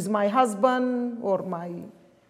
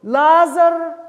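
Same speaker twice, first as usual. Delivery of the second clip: calling the name out high and loud; a preacher's ringing call of Lazarus; 7 semitones higher, 8 dB louder than usual. Retold or imitated. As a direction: call Lazarus out of the tomb, full voice. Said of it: "He called a loud voice".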